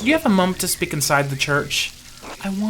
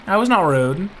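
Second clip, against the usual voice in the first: ordinary talk, nothing marked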